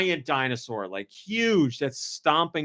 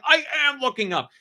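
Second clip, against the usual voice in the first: high-pitched indignant insistence; mock defensive dad, voice lifted high; imitating protesting dad; 12 semitones higher than usual. Dad protesting to his nagging daughter, mock-indignant.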